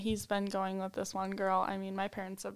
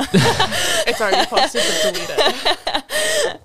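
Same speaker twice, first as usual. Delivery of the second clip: higher, louder through laughter; laughing; 8 semitones higher, 17 dB louder, much slower than usual. Real, not acted. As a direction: laugh while pleading. Laughing mock plea.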